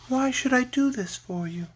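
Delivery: sweet lovely lady. A narrator's reading. Lady Oz challenging the Scarecrow, sweet. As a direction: ask it lightly, high and gentle.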